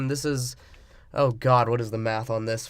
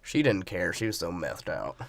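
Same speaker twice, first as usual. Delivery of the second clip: put-on Bama boy; 8 semitones lower, 5 dB quieter than usual. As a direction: play a subdued Southern country boy. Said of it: "Bama boy".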